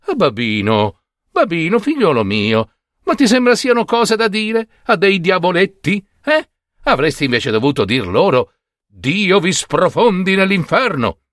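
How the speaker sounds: a hint of an exasperated mother, rising high; scolding mother. Mother scolds Babino, mildly exasperated. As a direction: chide your foolish son. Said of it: "gli disse".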